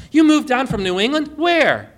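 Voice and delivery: eager, amazed question; a friendly stranger's excited double take; surprised. Retold or imitated. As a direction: pitch jumps high in delighted surprise.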